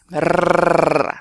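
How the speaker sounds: long, loud rolled R; rolled R trill. Shown as a demonstration. Teacher demonstrating a vibrating R, long loud trill. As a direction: sustain a strong tongue-tip trill. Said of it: "talk about rolling their R's".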